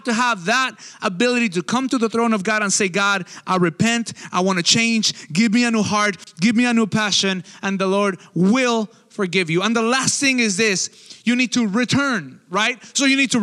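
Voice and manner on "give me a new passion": usual speaking voice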